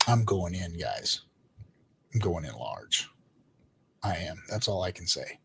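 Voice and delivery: quietly confessing to the viewers; hushed confession. Real, not acted. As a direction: drop it almost to a murmur like a secret. Confessing big buy, voice drops.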